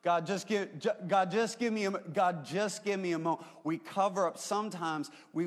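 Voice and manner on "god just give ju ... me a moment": higher, louder mock frustrated prayer; mock pleading prayer. Pastor imitating a frustrated driver praying, higher mock plea. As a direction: play-act a desperate plea to God. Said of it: "cover up our Jesus".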